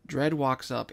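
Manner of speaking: ordinary delivery, nothing marked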